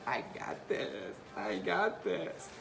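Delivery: slow whispered pep talk. Retold or imitated. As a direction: whisper it to yourself slowly. Whispered self-reassurance.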